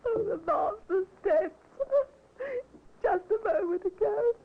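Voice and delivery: tearful, rising sobbing report; crying out the news between sobs; weeping. Acted. Maid telling Brother Arthur the master died, weeping. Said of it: "a weeping Dottie told".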